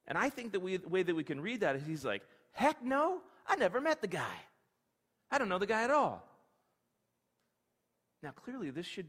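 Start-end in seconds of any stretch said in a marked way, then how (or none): 2.5-6.3 higher, indignant brush-off; flat refusal to know him; emphatic denial. Acted. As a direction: wave the question away hard. Peter's third denial.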